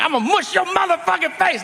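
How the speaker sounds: high, strained mock yell; hammed-up angry inmate; threatening prisoner. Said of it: "he's like".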